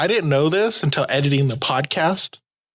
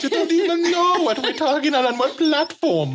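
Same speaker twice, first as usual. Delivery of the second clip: high-pitched, slipping fake British accent; hammed-up British that wanders off course; bad British accent; 10 semitones higher than usual. Co-host mock-baffled about platforms, bad British accent. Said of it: "I don't think that's British anymore".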